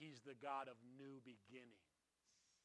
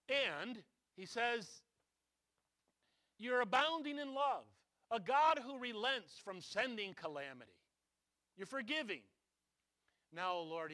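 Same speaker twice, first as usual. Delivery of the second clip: loud preaching; 6 semitones higher, 12 dB louder than usual. Acted. Preaching Jonah's prayer loudly.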